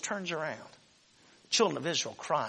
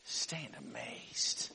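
much lower, slow, softer; awed low murmur; 10 dB quieter, much slower than usual. Retold or imitated. Moses telling Israel in a slow low murmur to stand amazed. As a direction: let the wonder sink in. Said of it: "And then he says".

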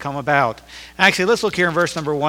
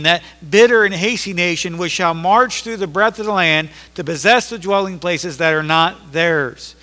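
scripture reading; 3 semitones higher, 4 dB louder than usual. Acted. Reads God's answer from scripture.

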